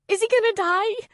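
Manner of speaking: high frightened plea; crying out. A narrator's reading. Girl asking Kelly about her dog, frightened. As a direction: beg to know, scared. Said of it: "Courtney cried".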